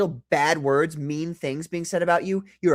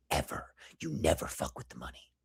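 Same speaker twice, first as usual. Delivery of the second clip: menacing whisper; 12 dB quieter than usual. Real, not acted. Host warning whoever messes with people's money, whispered menace. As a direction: whisper it like a final threat.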